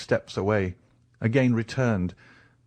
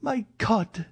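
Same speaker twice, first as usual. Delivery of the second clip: anguished cry. Acted. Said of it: "said Valentine".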